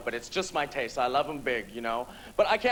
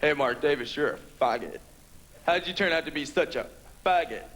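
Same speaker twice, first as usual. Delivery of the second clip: lower-pitched mock bully voice; lightly voiced bully, taunting in a lower register; 4 semitones lower than usual. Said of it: "The guys that come up to you in high school and go".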